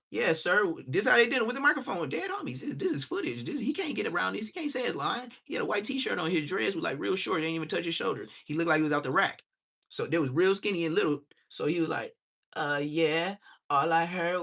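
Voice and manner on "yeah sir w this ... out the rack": mocking news guy impression. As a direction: play him breathless and pushy on the mic. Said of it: "so this is how they do 'em".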